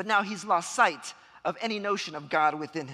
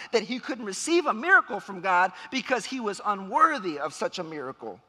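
ordinary delivery

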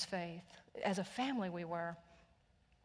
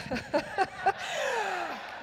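high burst of laughter; 12 semitones higher, 6 dB louder than usual. Real, not acted. Laughing at her father's joke.

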